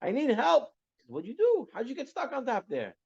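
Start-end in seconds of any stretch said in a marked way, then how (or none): 0.0-0.7 acted-out cry for help, pitched way up; retold scream for help. Stuck servant screaming down to his master, high mock yell. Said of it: "now he's screaming down". 1.1-3.0 high-pitched puzzled questioning, acted out; baffled master. Master calling to the stranded servant, baffled high voice.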